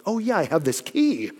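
lightly acted "oh, by the way" discovery; mock casual realization. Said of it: "the most unclimactic part of the book".

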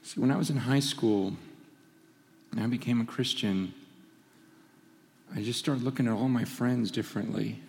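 low, hushed and heavy; solemn, subdued. Real, not acted. Preacher asking congregation about weeping for the lost, solemn and hushed. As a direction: speak low and soft, weighing each phrase. Solemn question and confession of grief.

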